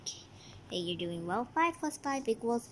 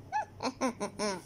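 sly laugh; 4 semitones lower, 4 dB quieter than usual. Acted. Evil laugh after plan.

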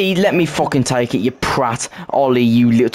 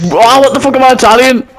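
much higher, louder and fast; loud outburst; 11 semitones higher, 10 dB louder, faster than usual. Real, not acted. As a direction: blurt it out in a yell. Outburst at friends' teasing.

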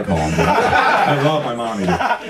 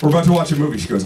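ordinary talk

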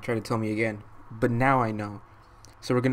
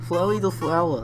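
Flowey the Flower pitched up in a cutesy voice; high flower voice; 5 semitones higher than usual. Acted. A gamer voicing the friendly flower, high and cutesy. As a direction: chirp it like a cheerful cartoon flower.

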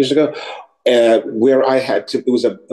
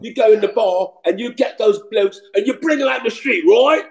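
high-pitched English bloke accent; put-on English accent; 9 semitones higher than usual. Shown as a demonstration. Actor voicing the other character for rehearsal, high English accent. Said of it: "speaking that other person's in their accent".

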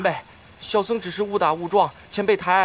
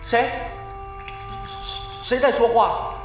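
startled. Acted. Startled monk hears hidden voice.

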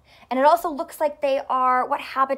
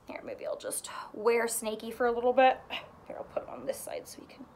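whisper; 7 dB quieter, much faster than usual. Real, not acted. Whispered aside while fixing puppet.

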